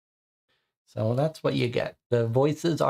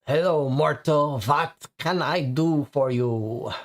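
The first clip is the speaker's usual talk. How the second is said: vampire voice. Acted. Vampire larper greets player.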